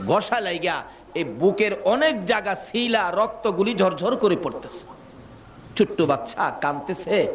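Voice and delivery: normal talking voice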